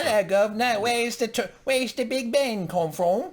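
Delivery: very high, loud cartoon English accent; hammed-up mock English tourist. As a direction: squawk it in a silly English accent. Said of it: "Let me hear your best English accent".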